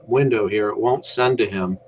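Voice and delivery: ordinary talk, nothing marked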